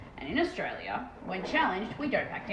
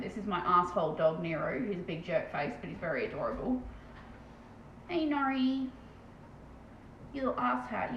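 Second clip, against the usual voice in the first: ordinary talk, nothing marked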